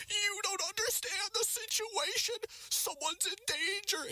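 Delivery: put-on very high, slow frail old lady voice; squeaky granny pleading; old woman voice. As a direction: mimic a panicked old woman, high and drawn out.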